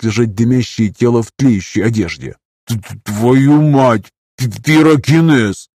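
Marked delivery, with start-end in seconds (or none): 2.7-5.7 slow stuttering, stunned exclamation; stammering in shock. A narrator's reading. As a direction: stutter out the words, aghast. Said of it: "оторопело воскликнул Николай".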